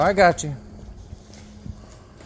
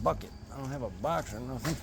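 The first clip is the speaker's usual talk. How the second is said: muttering; 8 semitones lower, 11 dB quieter than usual. Real, not acted. Quiet muttering to self.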